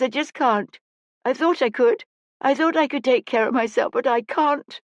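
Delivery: put-on high-pitched, distraught child's outburst; crying child's despairing lament, pitched high; tearful. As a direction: pour out grief on the edge of sobbing. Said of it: "and started to cry".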